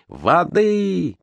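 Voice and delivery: high demanding cry; shouting. Acted. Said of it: "кричали матросы".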